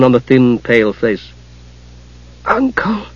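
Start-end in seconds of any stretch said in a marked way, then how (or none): 2.4-3.1 barely audible call; whisper. Acted. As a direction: breathe the word out softly. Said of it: "It was only a whisper".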